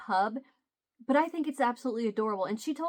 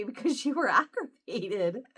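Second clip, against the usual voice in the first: laughing. Real, not acted. Laughs at daughter's pretended aggravation.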